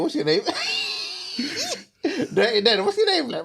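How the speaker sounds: giggling through the words; can't hold the laughter in; laughing. Real, not acted. Mock pitcher cracking up mid-question.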